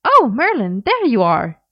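overplayed innocence, clearly scheming; too innocent. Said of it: "in a too innocent voice".

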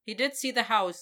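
ordinary delivery, nothing marked

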